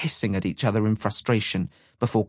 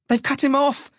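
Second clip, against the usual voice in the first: very high panicked cry; alarmed scream; 4 dB louder than usual. A narrator's reading. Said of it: "Shine screamed".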